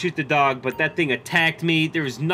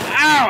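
pained yelp; 10 semitones higher, 7 dB louder than usual. Pretend. Hit during gunfight.

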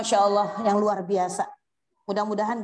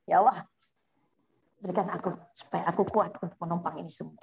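prayer; 6 semitones lower than usual. Acted. A preacher modelling a prayer for tired working mothers, pleading. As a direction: open with a cry, then settle into humble pleading.

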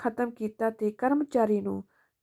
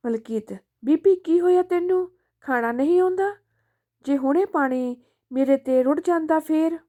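higher, louder angry snapping at his mother; angry scolding; 6 semitones higher, 5 dB louder than usual. Acted. Son scolds his mother over spilled water, angry higher shout. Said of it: "ਆਪਣੀ ਬੀਬੀ ਦੇ ਗਲ ਪੈ ਗਿਆ".

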